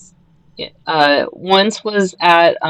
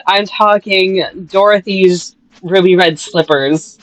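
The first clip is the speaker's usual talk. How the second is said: ordinary talk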